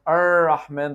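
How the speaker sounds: 'Ar-Rahman' with a dark curled 'er'; American R. Shown as a demonstration. Teacher recapping mistakes, dark American R. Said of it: "the American one".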